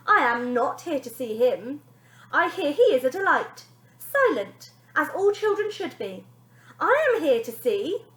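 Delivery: high, loud, grand nanny voice; haughty nanny. Acted. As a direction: speak like a superior TV nanny. Wonder Nanny addressing the family.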